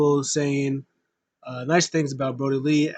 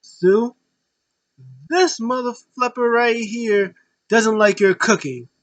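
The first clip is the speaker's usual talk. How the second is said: higher, louder, slow hammed-up rant; mock angry maniac; 8 semitones higher, 6 dB louder, slower than usual. Brody Lee mock-yelling at Sue about her cooking. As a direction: play the unhinged heel for laughs. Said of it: "and then Brody Lee goes".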